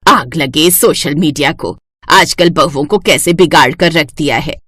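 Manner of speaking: low, bossy mother-in-law voice; a domineering saas giving orders; stern mother-in-law. Acted.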